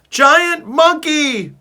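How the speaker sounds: very high, loud, slow shriek; panicked scream. A narrator's reading. The cat panicking at the giant ape, shrieking. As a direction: shriek in terror.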